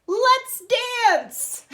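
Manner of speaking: high, loud gleeful invitation; excited bear. Acted. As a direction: burst out with joy. Bear invites dancing in story.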